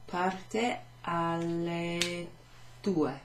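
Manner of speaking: very slow, parte stretched; slow careful teacher answer; slow model answer. Shown as a demonstration. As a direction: draw out "Parte alle due" for learners. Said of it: "We could answer it".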